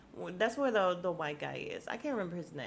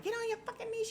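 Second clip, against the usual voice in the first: very high-pitched mock command; imitated fierce order; faster than usual. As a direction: mimic her ordering someone down, way up high. Retold order at gunpoint.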